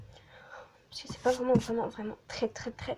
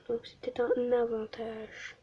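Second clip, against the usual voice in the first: ordinary talk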